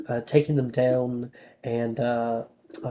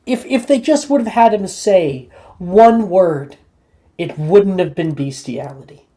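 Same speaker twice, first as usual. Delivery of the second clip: excited; 5 semitones higher, 8 dB louder than usual. Real, not acted. Joking rant, building emphasis.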